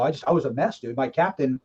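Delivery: usual speaking voice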